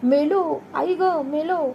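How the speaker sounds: high pitched panicked cry; boy pushed into the well, crying out in fright; frightened cry. Retold or imitated. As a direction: cry out as if drowning. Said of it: "मी ओरडलो".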